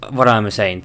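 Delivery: usual speaking voice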